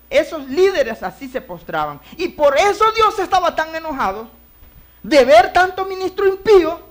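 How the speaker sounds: loud, high preaching cry. Acted. Preacher calling out to the congregation, loud. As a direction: preach it out to the church.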